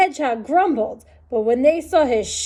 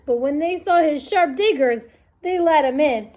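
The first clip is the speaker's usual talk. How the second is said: usual speaking voice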